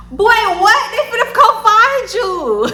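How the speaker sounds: high, loud and laughing, slowing down; laughing disbelief. Real, not acted. Laughing in disbelief at a guy who implicated himself. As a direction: crack up in disbelief at the guy's blunder.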